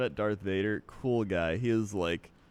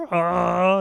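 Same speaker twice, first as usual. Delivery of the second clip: loud, high wordless wookiee bellow; mock creature roar; imitated Chewbacca roar; 8 semitones higher, 9 dB louder than usual.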